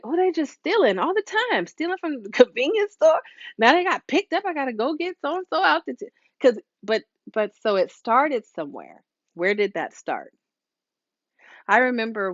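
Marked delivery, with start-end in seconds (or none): 0.0-6.1 higher mock exasperated parent; complaining parent. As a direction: parent griping to others about a thieving child. Parent complaining about stealing child.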